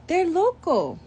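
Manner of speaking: put-on a kid giggling at crazy skulls; playful kid voice.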